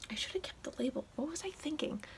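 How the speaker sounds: very soft, whispery aside; hushed muttering to oneself; whispered self-reproach. Real, not acted. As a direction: whisper a little scolding to yourself. Scolds herself in an aside.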